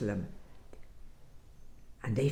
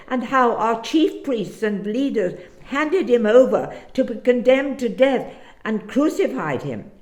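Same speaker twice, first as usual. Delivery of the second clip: higher, louder, eager and urgent storyteller; a disciple pouring out the whole story, pitch raised; excited disciple; 3 semitones higher, 4 dB louder than usual. Acted. Cleopas tells the stranger what happened, raised and urgent.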